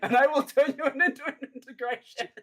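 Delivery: hammed-up menace through laughter; laughing mock threat. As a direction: giggly pretend threat. Guest jokingly threatens voters.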